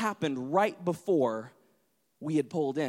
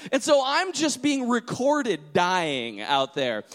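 usual speaking voice

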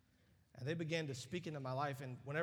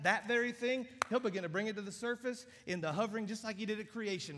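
louder and higher, full-force preaching climax; building preaching; 7 semitones higher, 7 dB louder than usual. Acted. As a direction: lift the room with rising fervor.

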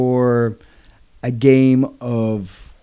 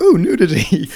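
mock-excited ooh, higher pitched; hammed-up leering delight at the word; excited pervert; 6 semitones higher, 4 dB louder than usual. Co-host denies getting excited like a pervert, mock excited. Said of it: "excited by being a pervert, being like".

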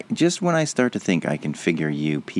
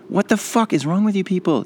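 much higher, exasperated cry; angry rant at boomers, much higher; exasperated outburst; 13 semitones higher than usual. Real, not acted. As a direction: throw it up in disbelief.

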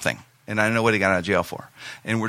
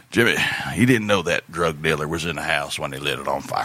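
lower, offhand uncle voice; casually low as if nothing; uncle's voice; 9 semitones lower than usual. Retold or imitated. Uncle calmly explaining the arson, low offhand voice. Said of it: "And he went".

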